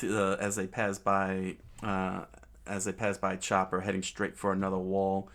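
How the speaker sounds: ordinary delivery, nothing marked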